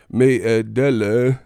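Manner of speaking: a hint of Forrest Gump. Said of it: "sound like Forrest Gump".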